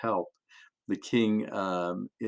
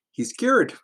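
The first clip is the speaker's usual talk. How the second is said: joyful announcement; 13 semitones higher, 6 dB louder than usual. A narrator's reading. Healing climax in story.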